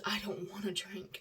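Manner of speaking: unvoiced whisper. Real, not acted. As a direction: whisper it tiredly.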